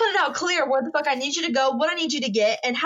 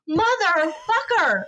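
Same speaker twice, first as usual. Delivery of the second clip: exasperated curse; 6 semitones higher, much slower than usual. Retold or imitated. Hears husband ordered elsewhere.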